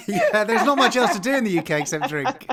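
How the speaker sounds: real laughter through the words; chuckling joke about drinking; laughing. Real, not acted. Host laughs along at joke.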